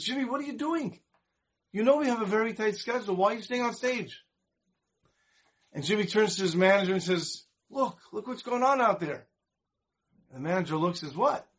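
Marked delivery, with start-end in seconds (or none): 0.0-4.2 higher, incredulous scolding; a frustrated manager; mock exasperation, pitched up; exasperated manager. Manager scolds Jimmy offstage. 7.7-9.3 higher, insistent look-at-this; excited nudge to look; lightly acted eager performer; eager urging. Jimmy urges manager to look. 11.2-11.5 high rising question; surprised, not getting it; a quick mock puzzled what. Manager puzzled reply.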